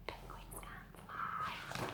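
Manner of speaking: slow soft whisper. Real, not acted. Reader whispering to the child, slow. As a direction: breathe it as a secret aside.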